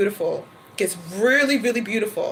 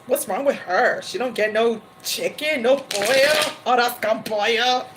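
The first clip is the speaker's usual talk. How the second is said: a hint of a gossiping cook; lightly voiced staff gossip. Staff gossiping about her order, lightly voiced. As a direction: mutter about the odd customer. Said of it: "they're looking at me like".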